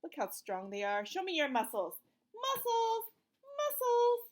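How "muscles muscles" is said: high, playful sing-song; playful squeal. Pretend. A mother playing with her child, high and playful. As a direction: squeal it like a game.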